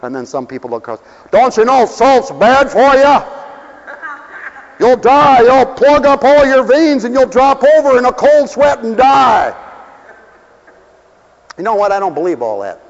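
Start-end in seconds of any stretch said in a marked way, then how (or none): 1.3-9.5 louder, slower warning voice; mock alarmed scold. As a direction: scold a salt-lover like a worried neighbour. Voicing health-scold diners.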